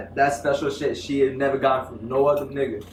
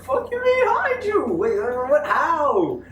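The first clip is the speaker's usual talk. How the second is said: very high squealed disbelief, slow and drawn out; mock panic; 13 semitones higher, 3 dB louder, slower than usual. Boyfriend to girl asking to be hidden, mock panic. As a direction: squeal it like a cornered boyfriend, played for comedy. Retells panic at request to hide.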